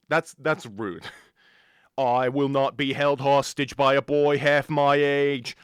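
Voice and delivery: put-on loud indignant British accent; huffy British accent, pushing back. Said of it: "you sound British".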